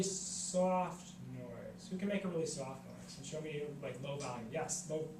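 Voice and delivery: softly, dropping in volume; hushed demonstration. Shown as a demonstration. Teacher illustrating a soft noise.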